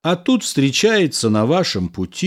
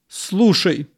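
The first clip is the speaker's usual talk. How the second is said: higher, sharp angry call to the husband; angry cry; 6 semitones higher than usual. A narrator's reading. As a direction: snap his attention, cross. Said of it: "сердито вскричала жена".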